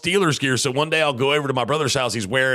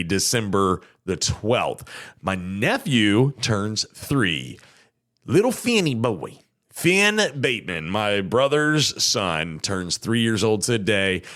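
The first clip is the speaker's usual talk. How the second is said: ordinary delivery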